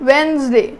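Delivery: Wednesday as wens-day, first d silent; correct pronunciation. Shown as a demonstration. Teacher modelling Wednesday for students, correct pronunciation. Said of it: "silent".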